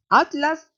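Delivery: "Atlas" alto y fuerte, separado at-las; pronunciación de España. Retold or imitated. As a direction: imita a un español diciendo "Atlas" como at-las. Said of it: "Ellos se paran así". ("Atlas" recalcado, con corte entre t y l)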